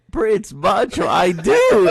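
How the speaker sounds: high, loud imitation of an amused worker; mock squeal of delighted recognition; tickled, incredulous exclamation. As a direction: blurt it high, about to burst out laughing. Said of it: "she started, like, dying laughing".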